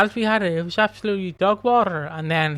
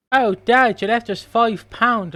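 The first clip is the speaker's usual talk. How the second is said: sarcastic thanks; 6 semitones higher, 4 dB louder, slower than usual. Pretend. Sarcastic thanks to dead dad.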